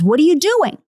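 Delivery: high, rising indignant demand; mock exasperated outburst; exasperated Balaam. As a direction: scold in disbelief, pitch leaping up. Balaam angrily whipping his donkey.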